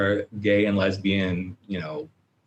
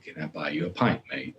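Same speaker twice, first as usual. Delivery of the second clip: low, soft attempt at an English accent; weak English accent; 4 semitones lower, 7 dB quieter than usual. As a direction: try an English bloke's accent, not very well. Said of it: "I usually can do that with an English accent. My English accent is not that good these days".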